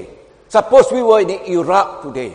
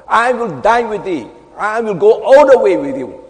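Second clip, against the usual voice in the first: raised, building to a loud vow; crowing boast; 3 semitones higher, 5 dB louder than usual. Acted. Disciple vowing to follow Jesus to death, boastfully. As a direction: proclaim it like a proud rooster. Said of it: "that is the crowing cock".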